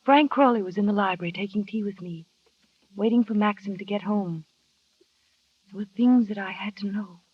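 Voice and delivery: measured, brooding first-person narration; story narration. A narrator's reading. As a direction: draw the listener into a haunted memory.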